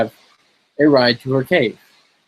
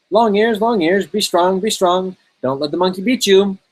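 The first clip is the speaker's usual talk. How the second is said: higher, louder cheering on; 7 semitones higher, 3 dB louder than usual. Acted. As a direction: urge them on from ringside. Cheering on wrestler.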